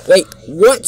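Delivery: loud, high startled question; shocked disbelief. Acted. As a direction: react in indignant surprise. Gordon hears he will be beaten.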